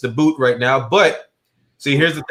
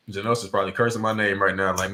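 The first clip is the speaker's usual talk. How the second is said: normal talking voice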